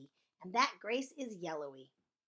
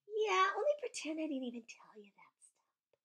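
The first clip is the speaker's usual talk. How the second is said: high, whispery confiding voice; hushed, high-pitched whisper; very soft; 9 semitones higher than usual. Acted. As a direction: whisper it like a secret slipped out. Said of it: "I said very soft".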